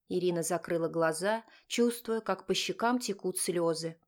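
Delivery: plain reading voice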